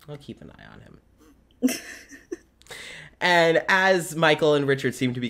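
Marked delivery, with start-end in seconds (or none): 0.1-1.0 much softer, lower repeat; hushed confiding. Acted. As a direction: barely breathe it. Repeats Claudius line much quieter.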